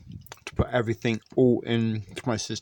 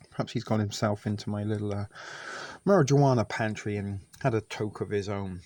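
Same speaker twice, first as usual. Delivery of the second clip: ordinary delivery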